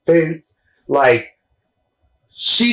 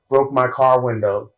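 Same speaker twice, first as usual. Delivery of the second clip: ordinary talk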